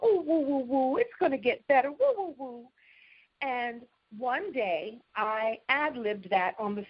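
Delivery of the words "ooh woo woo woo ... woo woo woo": higher, soothing singsong woo woo; a mother cooing to a hurt child; mother comforting. Retold or imitated. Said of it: "she would just go".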